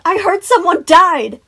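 put-on loud, high, drawn-out child's outburst; shocked gasp. A narrator's reading. Boy telling Sun the rumor, shocked outburst. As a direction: blurt it like a scandalized kid. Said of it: "Tyler gasped".

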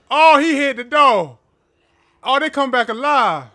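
shouting as the enemies come back; alarmed yelling. Real, not acted. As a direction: cry out in real alarm. Monsters break through the door.

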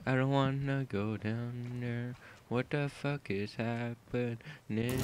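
soft, slow hushed voice; creeping through a scary hallway whispering; scared whisper. Real, not acted. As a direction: whisper it nervously.

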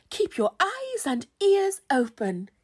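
high, loud, grand instruction; hairy godmother. A narrator's reading. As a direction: advise with theatrical importance. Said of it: "said the hairy godmother".

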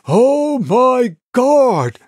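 high, drawn-out exclamation of horror; horrified cry. A narrator's reading. Onlooker seeing the stranger unmasked, horrified cry. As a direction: wail it in shock.

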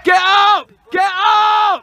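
high, loud drawn-out yell; real touchline shout; shouted command. Real, not acted. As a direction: holler it across the pitch. Coach shouting to team.

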